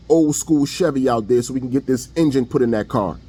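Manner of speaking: low, unbothered father lecturing his son; dad giving advice. Retold or imitated. Father telling his heartbroken son to shake it off, low fatherly voice. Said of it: "dad is gonna say".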